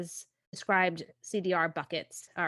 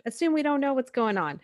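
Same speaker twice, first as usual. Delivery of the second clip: higher-pitched retold nagging from husband; mock scolding; 6 semitones higher, 3 dB louder than usual. Host quotes husband asking for simpler explanations, mock scolding. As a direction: lightly imitate a scolding, voice lifted. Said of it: "scolds me about".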